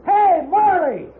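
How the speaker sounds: slow, high, loud call across open ground. Acted. Old gunman calls out to the enemy from cover, shouting. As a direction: yell the name out long and high.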